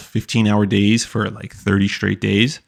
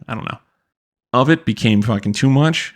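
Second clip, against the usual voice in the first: ordinary delivery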